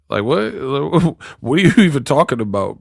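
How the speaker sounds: usual speaking voice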